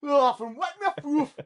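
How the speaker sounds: hammed-up Geordie-Yorkshire; northern English accent. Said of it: "the mixture of accents there".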